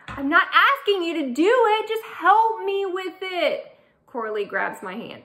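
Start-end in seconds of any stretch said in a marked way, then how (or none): 0.1-3.6 pleading friend. Acted. Coralie pleading.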